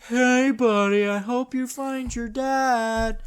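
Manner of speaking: high, slow, sweet cartoon voice; drawn-out friendly narwhal. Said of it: "they use a voice".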